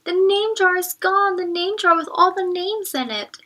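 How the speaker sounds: high, loud urgent shout. A narrator's reading. Ralph shouting to the teacher, high and loud. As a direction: blurt it out in alarm. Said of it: "Ralph shouted at him".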